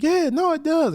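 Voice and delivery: squeaky high-pitched Lamar, sure of himself; high mock crackhead voice. Old crackhead to David at sentencing, high squeaky voice. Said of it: "He's like".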